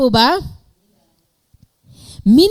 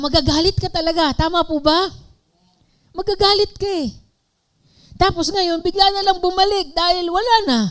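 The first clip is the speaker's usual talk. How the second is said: rising indignation; 11 semitones higher, faster than usual. Real, not acted. Preacher voicing resentment.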